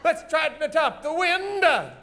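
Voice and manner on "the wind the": high, stretched cue calls; calling the cues. Shown as a demonstration. Host rehearsing audience sounds from the top, high drawn-out calls. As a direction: sing out each cue for the crowd.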